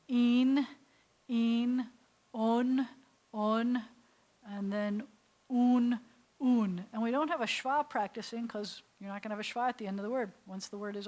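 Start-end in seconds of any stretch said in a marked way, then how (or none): 0.1-3.8 loud, separate syllables for practice; sounding out syllables. Shown as a demonstration. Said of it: "sound it out like this". 5.5-6.9 "un" held firmly as a drill; sounding out syllables. Shown as a demonstration. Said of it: "sound it out like this".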